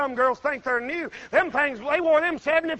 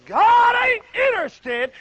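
preaching shout; 8 semitones higher, 5 dB louder, slower than usual. Real, not acted.

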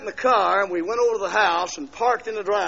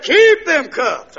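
astonished guard; 8 semitones higher, 10 dB louder, much slower than usual. Retold or imitated. Guard retold, amazed.

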